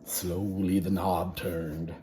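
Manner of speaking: slow, hushed suspense; slow creepy storytelling; suspenseful narration. A narrator's reading. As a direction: draw it out, let the tension build. Suspense as door opens.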